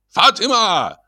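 high, urgent yell of a name; frightful high-pitched call; terrible-voiced shout. A narrator's reading. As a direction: holler for help across the flat. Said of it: "закричал Шаринов страшным голосом".

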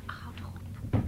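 whispered hush. Acted. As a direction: hush her in a low whisper. Dochter sist moeder stil. (fluisterend tegen moeder)

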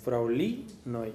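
slow, soft teacher model of Fraŭlinoj. Shown as a demonstration. Teacher slowly repeats Fraŭlinoj for the student, soft and clear. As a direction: draw out Fraŭlinoj clearly, syllable by syllable. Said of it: "teacher models again".

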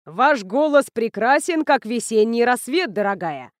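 oily showman flattery; gushing host. Acted.

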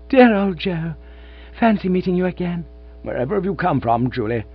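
delighted. A narrator's reading. Reunited lovers chatting.

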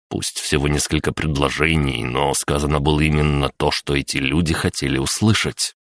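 ordinary delivery

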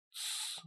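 whispered shh; hushing hiss. Acted. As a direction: hiss for silence. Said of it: "шипит Лысый". (шипящее тсс)

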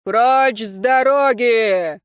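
drawn-out booming command; long, loud clear-the-road shout; thundering. A narrator's reading. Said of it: "загрохотал за их спинами мужской голос".

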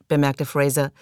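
usual speaking voice

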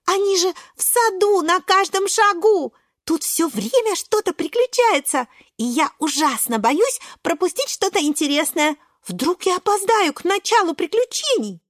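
put-on high, breathless-with-excitement child character; eager little Шпинатик. Шпинатик telling friends about garden adventures, high and excited.

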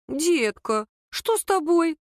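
high-pitched, fussing concern; sudden worried flutter, voice lifting; alarmed. A narrator's reading. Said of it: "Тут же всполошилась свекровь".

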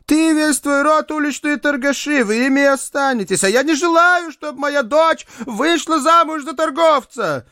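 very high-pitched scolding outburst; indignant tirade. A narrator's reading. Mother rejecting a street trader as son-in-law, indignant high-pitched tirade. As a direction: rail at them in a high, outraged voice. Mother berates her daughter's suitor.

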